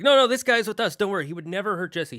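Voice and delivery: high, loud, hurried objection; urgent shout. Acted. Buzz stopping the soldiers from hurting Woody, loud and high. Buzz rushes in to stop them.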